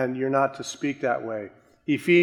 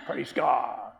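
lightly put-on pious voice with a laugh; mock preacher voice. Mock-greeting a friend at the mall in pious voice. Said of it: "Would you talk to your friend like that at the mall?".

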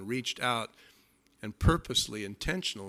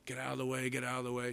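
softer, muttered push through the crowd; hushed pushing through; 3 semitones lower, 9 dB quieter, faster than usual. Retold or imitated. Woman pushing through crowd, mock hushed urging. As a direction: mutter get out of the way.

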